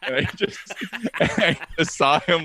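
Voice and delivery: high-pitched, breaking into giggles; laughing while talking. Real, not acted. Teller recalling the outwitted tow driver, laughing. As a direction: struggle to tell it through laughter.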